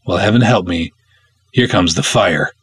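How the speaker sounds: usual speaking voice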